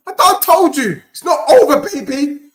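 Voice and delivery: loud, high, insistent outburst; excited I-told-you-so shout; triumphant gloating. Real, not acted. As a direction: crow it loudly to the doubters. Gloating as team leads.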